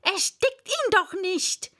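high, loud exclamations; clamour of voices. Acted. Crowd fussing over fallen Wabbel, high urgent shouts. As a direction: call out urgent instructions, one after another. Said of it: "dann wieder verschworene Stimmen".

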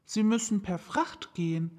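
put-on girl's voice, thinking aloud; Alice figuring it out; musing little girl. Alice musing about sending presents to her feet.

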